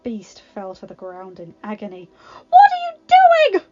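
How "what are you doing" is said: very high, shrieked cry; scream. Acted. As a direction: scream it at the attacker. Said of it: "Belle screamed".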